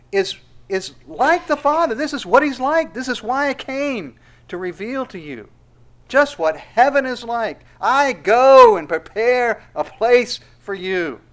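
full-force sermon, slowing on the promise; preaching at full force. Acted. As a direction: proclaim the promise with weight. Preaches Jesus' words loudly.

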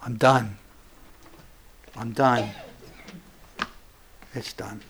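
low, sinking resignation; quiet despair. Acted. Peter recalls Jesus' warning, quiet despair. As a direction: give up, fading away at the end.

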